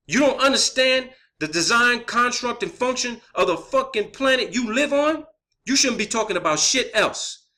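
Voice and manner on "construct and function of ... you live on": ordinary talk, nothing marked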